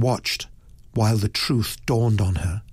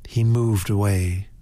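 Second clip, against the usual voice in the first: ordinary delivery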